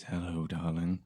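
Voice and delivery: softly imitated deep-voiced greeting; mock deep voice. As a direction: lightly imitate a man dressed as a woman. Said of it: "a really deep voice".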